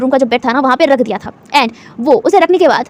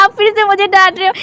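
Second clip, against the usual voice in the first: high, tearful complaint; whiny fake crying; 5 semitones higher than usual. Wing complains tearfully to Lan Wang Ji, mock crying. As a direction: pretend sobbing to guilt him. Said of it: "wing फिर से रोते कहते हैं".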